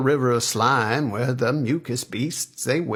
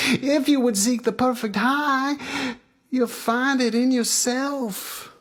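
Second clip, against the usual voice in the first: high, sustained wise-man pronouncement; guru Baba Fats; 13 semitones higher than usual. Acted. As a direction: hand down the secret, held high.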